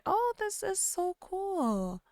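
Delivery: high, soft and slow, amazed; slow hushed awe; awed wonder. Real, not acted. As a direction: breathe it out in wonder. Arriving, amazed.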